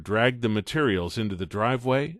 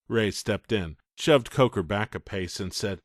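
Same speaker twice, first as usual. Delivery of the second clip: plain reading voice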